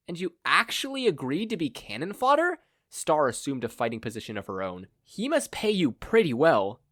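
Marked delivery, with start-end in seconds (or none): 0.1-2.6 much higher, taunting; mocking hero. Hero taunts the villains, mocking. 5.0-6.8 much higher, taunting; mocking hero. Hero taunts the villains, mocking.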